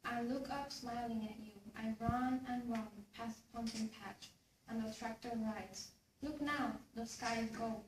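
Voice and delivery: ordinary talk, nothing marked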